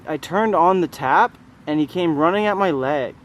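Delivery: ordinary talk, nothing marked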